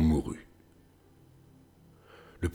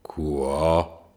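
giant voice; 4 semitones lower than usual. A narrator's reading. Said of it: "dit le géant".

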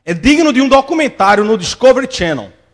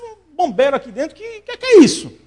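startled outburst; 7 semitones higher than usual. Retold or imitated. Shopper startled by screaming.

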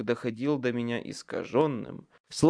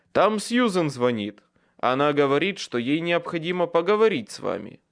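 calling down; 5 semitones higher, 5 dB louder than usual. A narrator's reading. Michael calls down through hatch.

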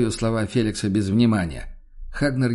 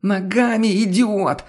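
high, louder outburst cracking into a squeal; hoarse shriek; 10 semitones higher, 4 dB louder, slower than usual. A narrator's reading. Woman outside answers a stupid question, hoarse shriek. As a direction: shriek the insult, voice breaking hoarse. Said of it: "Сорвавшись на хриплый визг".

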